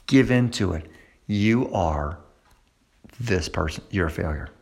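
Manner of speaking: low, soft sinister voice; the lie after a failure, low and dark. Acted. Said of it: "this is what you hear".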